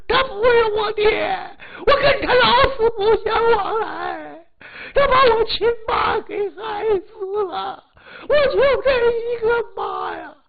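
very high, screaming sobs; wailing daughter. Acted. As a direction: shriek it out through tears, gradually losing force. Daughter rejects father and stepmother.

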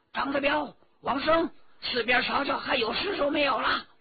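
loud, high shouted order; officer calling out to his men across open ground; 高声吆喝. Acted. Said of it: "一名把总高声吆喝". (军官大声吆喝手下)

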